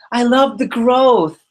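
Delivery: high, drawn-out mock gushing; gushing enthusiast. Mimicking a colleague's meeting debrief, exaggerated gushing. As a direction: gush it slowly in a lifted imitation voice.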